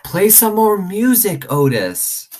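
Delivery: high, loud, slow call over the racket; calling out over the animal din; shouting over noise. A narrator's reading. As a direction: shout to be heard over barking. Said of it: "I shouted over".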